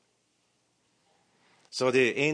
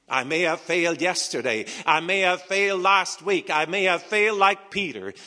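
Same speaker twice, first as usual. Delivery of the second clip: rising, louder preacher cadence; preaching build; 6 semitones higher, 7 dB louder than usual. Acted. Preacher building force over his failings. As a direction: drive each line harder to the congregation.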